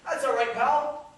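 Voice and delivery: slow, loud and easy, pal rising; a casual passerby brushing it off; friendly stranger. Retold or imitated. Stranger on the street forgiving Murray, breezy. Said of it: "he goes".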